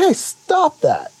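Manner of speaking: high, drawn-out mock telling-off; mock scolding. Scolding people who need a quick slap, mock rebuke. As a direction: pretend to slap some sense into them.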